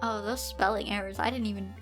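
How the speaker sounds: plain reading voice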